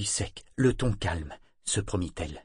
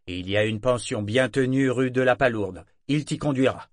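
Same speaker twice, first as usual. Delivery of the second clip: louder, weighty captain's verdict; stern captain; 3 semitones higher, 5 dB louder than usual. A narrator's reading. Captain sending the young sailor ashore, firm and loud. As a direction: hand down the sentence firmly.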